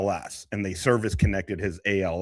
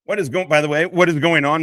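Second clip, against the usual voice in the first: excited greeting; 7 semitones higher, 6 dB louder than usual. Real, not acted. Greeting the returning streamer.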